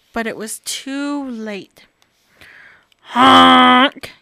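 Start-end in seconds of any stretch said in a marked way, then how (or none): 3.1-4.1 loud honking goose. Acted. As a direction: honk like a goose. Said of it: "Gabby hooted".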